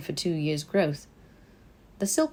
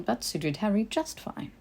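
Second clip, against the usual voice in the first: ordinary delivery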